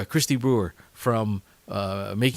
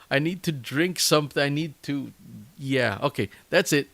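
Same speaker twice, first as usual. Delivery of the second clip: usual speaking voice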